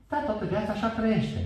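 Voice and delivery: a high, sing-song jeer; mocking dismissal. Preacher mimicking someone who gives up on change, in a high mocking voice.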